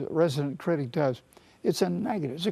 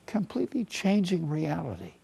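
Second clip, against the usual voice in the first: usual speaking voice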